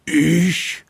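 alarmed high squeak of a yelp; a frightened little ich; startled. Acted. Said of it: "schüttelt erschrocken den Kopf".

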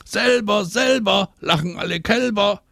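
put-on high, taunting children's chant; calling out. A narrator's reading. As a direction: chant the rhyme high like a playground jeer. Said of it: "rufen Anna und Marie".

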